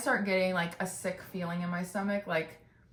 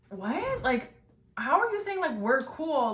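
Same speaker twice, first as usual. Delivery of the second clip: high, disbelieving question; incredulous; 6 semitones higher, slower than usual. Retold or imitated. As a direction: shoot up in total disbelief. Incredulous reply to girl.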